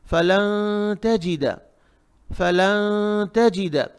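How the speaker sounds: फलन तजिदा slow, the n held in the nose; hidden noon, ikhfa. Shown as a demonstration. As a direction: hum the n of फलन before तजिदा. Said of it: "नाक में छुपाना है".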